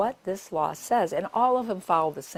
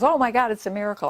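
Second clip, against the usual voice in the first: fast, loud mock amazement; amazed surgeon; 5 semitones higher, 7 dB louder, faster than usual. Surgeon seeing the patient say hi, fast amazed exclaim. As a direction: blurt it out in retold astonishment.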